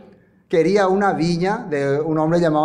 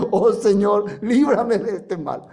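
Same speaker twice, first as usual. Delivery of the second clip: mock prayer; 4 semitones higher than usual. Preacher acts out a prayer.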